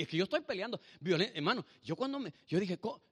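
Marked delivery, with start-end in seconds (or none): none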